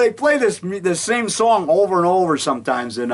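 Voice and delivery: usual speaking voice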